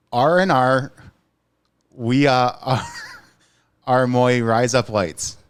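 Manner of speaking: hesitant reading in Australian; Australian accent. Pretend. Cohost reading the Australian text aloud, Australian accent. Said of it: "read the entire thing".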